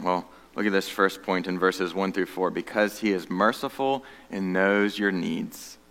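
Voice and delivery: usual speaking voice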